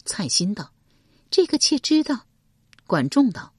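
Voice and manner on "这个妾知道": high, loud female character voice; young woman's voice. A narrator's reading. Concubine answering the chancellor, a bit louder. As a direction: lift into the concubine's voice.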